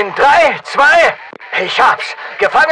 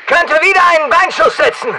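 ordinary talk

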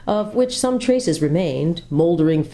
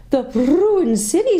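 lady's voice; 12 semitones higher, 3 dB louder, slower than usual. A narrator's reading. The lady repeats Jill's words.

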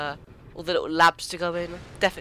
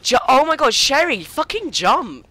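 frustrated shout; 8 semitones higher, 9 dB louder than usual. Real, not acted. Yelling at partner in game.

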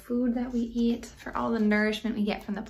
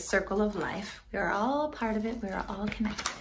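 ordinary delivery, nothing marked

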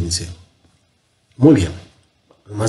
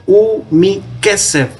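"u mi kesef" slowed and lifted higher; careful warning emphasis; 6 semitones higher, slower than usual. Shown as a demonstration. Teacher slows down to flag the Hebrew phrase "u mi kesef". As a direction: spell out "u mi kesef" slowly as a warning.

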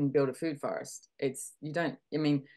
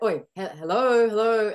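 calling hello; 5 semitones higher, 7 dB louder than usual. Retold or imitated. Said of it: "It's like".

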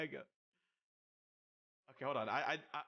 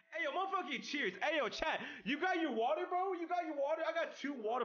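excited; 12 semitones higher than usual. Real, not acted. Hyped toast to chat.

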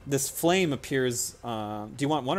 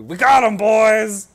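loud, high bandit whoop; a raider crowing over the catch; gleeful bandit shout; 10 semitones higher, 15 dB louder, slower than usual. Acted. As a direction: bandit calling his gang, loud and gleeful. Bandits ambush the party.

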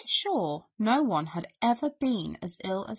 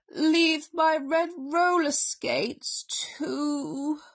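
very slow, raised bear voice; solemn invalid bear, leaving suspense; will-dictating bear; 6 semitones higher, much slower than usual. A narrator's reading. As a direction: draw out each bequest with ceremony.

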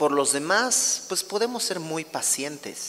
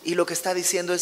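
normal talking voice

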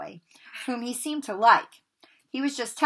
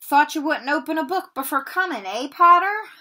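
higher, mocking teacher's taunt; sneering Snape; 7 semitones higher, 6 dB louder than usual. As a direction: needle the boy with a sneer.

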